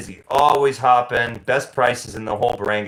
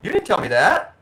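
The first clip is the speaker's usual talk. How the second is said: high, sudden "huh, who?"; baffled wife asking who he means; wife caught off guard, high and puzzled; confused surprise; 3 dB louder than usual. Retold or imitated. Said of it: "she's like".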